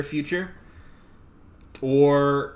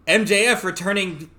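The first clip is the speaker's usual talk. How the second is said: mock headline read; 9 semitones higher, 4 dB louder, slower than usual. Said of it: "I saw the headline of".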